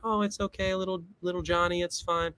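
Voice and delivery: high, soft mock-mom soothing; coddling mom. Mom comforting her crying boy after the game, mock coddling. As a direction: coo it like a mother babying her kid. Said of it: "their mom would cover".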